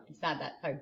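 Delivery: usual speaking voice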